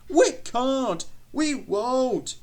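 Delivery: high, loud protesting truck voices; groaning trucks. A narrator's reading. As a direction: squeal it in stubborn complaint. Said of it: "groaned the trucks".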